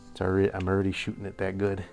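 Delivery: ordinary talk, nothing marked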